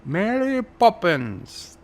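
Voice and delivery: slow, high British caricature; mock British accent. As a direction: ham up a silly British accent, drawn out. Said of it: "things British people say".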